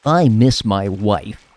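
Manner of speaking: put-on loud, slow over-enunciated American accent; exaggerated fake American accent, every word stretched; mock American accent. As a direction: drag out a parody American accent. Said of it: "we overly enunciate every single word".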